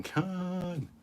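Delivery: high drawn-out Khan cry; Kirk yelling. Retold or imitated. Streamer quoting Kirk's famous drawn-out Khan yell. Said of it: "instead of Kirk yelling".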